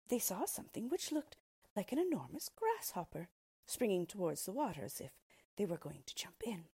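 ordinary delivery

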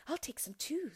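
put-on high, hushed little girl; a little girl whispering. A narrator's reading. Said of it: "said Gretel".

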